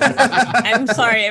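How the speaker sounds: laughing. Real, not acted. Laughing apology at the cartoon rule.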